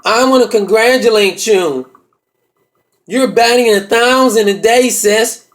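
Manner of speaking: drawn-out, deliberate character voice; brother's put-on voice. Brother congratulating his sister in the hallway, drawn-out put-on voice. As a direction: stretch the words out as the brother. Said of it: "He say".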